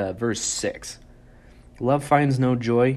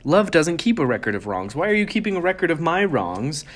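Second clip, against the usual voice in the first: mock indignation; 6 semitones higher than usual. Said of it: "I got really mad at that one".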